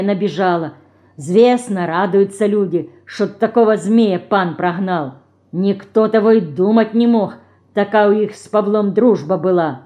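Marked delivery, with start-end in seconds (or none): none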